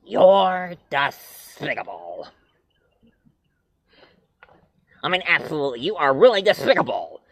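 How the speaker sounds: lightly voiced Daffy Duck; Daffy despicable. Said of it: "Daffy Duck go like".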